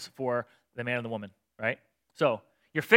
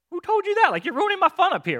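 very high, loud mock complaint; playful God complaining; 7 dB louder than usual. God teasing Adam and Eve for spoiling his fun, high and loud. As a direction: whine like someone's spoiled your fun.

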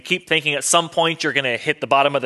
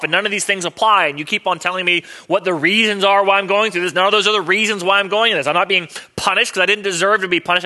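higher, louder, rapid exasperated complaint; Job's frustrated rant; 5 semitones higher, 3 dB louder than usual. Retold or imitated. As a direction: vent at the useless comforters. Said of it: "he's constantly saying".

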